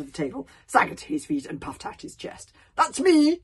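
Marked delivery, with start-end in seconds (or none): 2.7-3.4 high, loud, proud declaration; hearty. A narrator's reading. Stoic puffing out his chest to Alvin, hearty. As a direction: boom it out heartily. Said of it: "in much of his old hearty manner".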